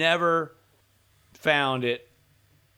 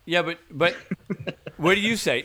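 ordinary talk

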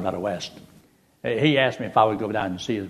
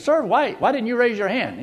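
puzzled preacher; 12 semitones higher, 3 dB louder than usual. Retold or imitated. Preacher questions man in back.

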